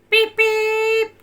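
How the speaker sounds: loud, high horn imitation; car horn. A narrator's reading. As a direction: honk like a car. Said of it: "a horn honked at him".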